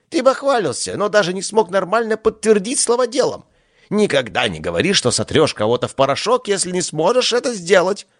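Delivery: high, stern theatrical rebuke; scolding god. Acted. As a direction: lecture the boaster from on high.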